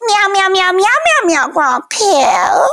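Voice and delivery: high cutesy cat meows; kitten meowing. Retold or imitated. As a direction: meow like a needy kitten. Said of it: "She's like".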